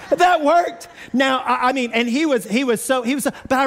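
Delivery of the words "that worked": high, louder amazed exclaim; can't believe it worked; stunned surprise. Retold or imitated. Third grader amazed the rain stopped, high and astonished.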